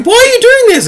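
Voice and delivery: squeaky high mock plea from the leader; whiny leader. Leader begging the Night Lords, in a squeaky mock plea. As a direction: pipe it up high like a pitiful plea. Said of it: "the leader is just going".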